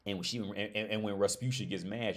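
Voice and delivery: ordinary delivery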